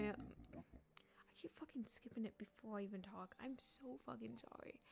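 unvoiced hushed whisper. Real, not acted. Gamer apologizing to a villager she skipped, whispering. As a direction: breathe the apology out. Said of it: "whispered apology".

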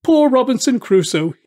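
high, loud quoted parrot call. A narrator's reading. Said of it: "he called him".

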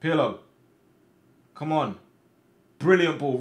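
ordinary talk, nothing marked

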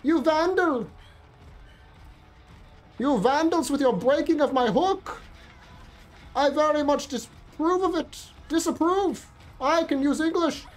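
high, hammed-up indignant scolding; mock-outraged rant. Player ranting at the vandals who broke his hook, hammed-up outrage. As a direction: play a prissy offended aristocrat.

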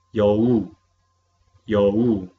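Youwu said clearly with a falling tone; teacher's model pronunciation. Shown as a demonstration. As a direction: model Youwu for students to repeat. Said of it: "vamos a pronunciar las palabras nuevas". (profesora pronuncia youwu con claridad)

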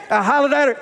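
normal talking voice

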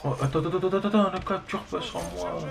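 rising, hurried repeated wait; urgent excited. Real, not acted. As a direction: urgently hold them back. Urgent game moment.

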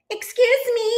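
tiny, very high call; little voice. A narrator's reading. Bluebird interrupts Duck and Goose, little voice calling out. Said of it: "a little voice called out".

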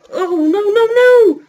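rising, loud no no no; panicked yell. Real, not acted. Gamer getting hit in the race, panicked yell. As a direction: cry out as it goes wrong.

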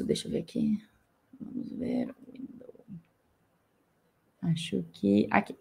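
whispered aside to self; whispering. Real, not acted. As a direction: mutter it under the breath. Searching the screen, aside.

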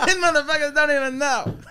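high, crowing stand-up voice; hammed-up comedian impression; Chris Rock. Shown as a demonstration. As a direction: gloat like a comic to the crowd. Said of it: "two impressions of Chris Rock".

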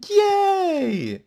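very high, loud, drawn-out squeal; mock cheer. Player learning his mic was muted, high mock cheer.

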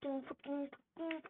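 usual speaking voice

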